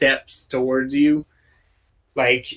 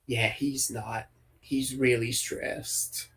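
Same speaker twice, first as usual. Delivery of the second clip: much softer, acted whisper; hushed aside; 2 semitones lower, 11 dB quieter, slower than usual. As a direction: confide it under your breath. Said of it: "whispered from the other side of you".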